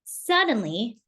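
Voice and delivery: ordinary delivery, nothing marked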